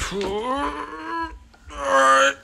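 high-pitched squeaky aside; high silly voice. Acted. Hunter pocketing an Xbox, high silly voice. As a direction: pitch it way up, falling gradually.

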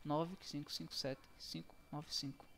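ordinary talk

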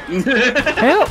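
high, loud damsel cry for help; damsel in distress. Pretend. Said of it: "You sound like you're the damsel in distress".

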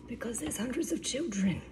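hushed, confiding exaggeration; joking whispered aside. Joking aside about the household.

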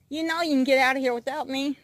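normal talking voice

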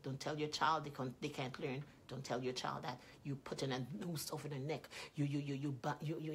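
usual speaking voice

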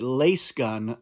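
las said like lace; long A and hissed S in lasgun; lasgun as "lace gun". Shown as a demonstration. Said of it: "Frank Herbert pronounced it".

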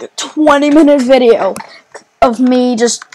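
usual speaking voice

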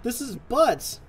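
real annoyed gamer rant; frustrated outburst. Real, not acted. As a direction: yelp the complaint in disbelief. Grenade hurts him again.

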